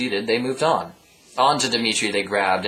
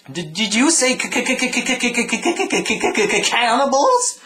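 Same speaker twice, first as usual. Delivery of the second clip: high, stammering terrified quack; squeaky duck stammering in fright; scared stutter; 12 semitones higher, 3 dB louder than usual. Acted. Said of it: "very scared or newly speech impedimented duck".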